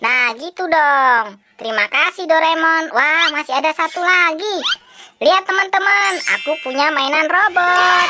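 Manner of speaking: put-on bright high pushy boy voice, pitched well up; a cheeky boy begging for toys; cheeky kid voice; high cartoon kid. Same kid character bragging.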